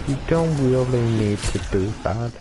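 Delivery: normal talking voice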